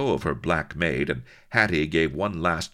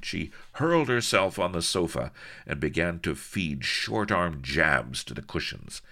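ordinary delivery, nothing marked

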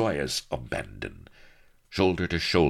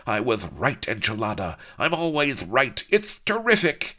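higher, rising gloat; gloating villain; 8 semitones higher, 3 dB louder than usual. Acted. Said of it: "he gloated".

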